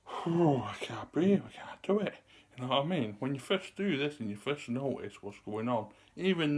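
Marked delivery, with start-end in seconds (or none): 0.1-2.2 mock breathless panic. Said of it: "your breathing is gonna be".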